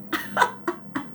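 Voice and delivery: unvoiced, breathy burst of laughter; breathless laugh. Real, not acted. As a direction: laugh under your breath. Laughing at the toddler's tantrum.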